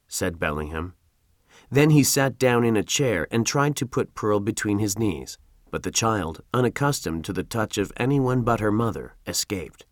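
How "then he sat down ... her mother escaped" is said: usual speaking voice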